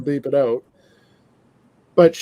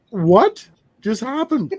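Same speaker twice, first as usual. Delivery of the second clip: slow, higher, baffled; replaying a jaw-drop moment, drawn out; stunned disbelief; 7 semitones higher, much slower than usual. Recalling the TV interview, slow stunned disbelief. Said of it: "And I'm like".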